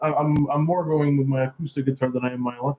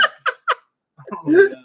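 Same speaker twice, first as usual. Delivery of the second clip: laughing; 4 dB louder, much slower than usual. Real, not acted. Laughing off his guitar skills.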